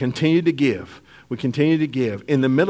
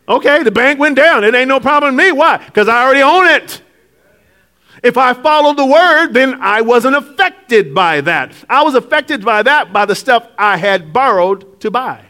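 raised, emphatic preaching; 7 semitones higher, 3 dB louder than usual. Acted. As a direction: drive the point home to the congregation. Preaching builds up.

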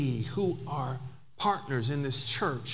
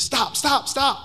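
high, loud repeated cry to stop; re-enacted overwhelmed outburst; imitated pleading outcry; 9 semitones higher, 7 dB louder, slower than usual. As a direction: cut in urgently, louder and much higher. Woman begs him to stop.

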